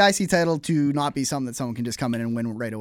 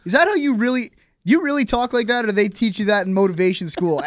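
higher mock announcer voice; imitating Michael Cole; 6 semitones higher, 4 dB louder than usual. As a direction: raise the pitch, ham up the announcer. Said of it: "Michael Cole was like".

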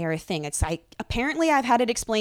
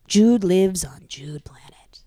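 whispering; 5 dB louder, slower than usual. Real, not acted. Whimsical aside about alien son.

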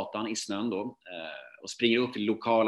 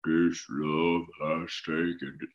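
slow, deepened imitation of a song; like a record playing too slow; dark distorted song; 4 semitones lower, much slower than usual. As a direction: drag it out low and slow. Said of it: "sången låter så här ... så mörkt".

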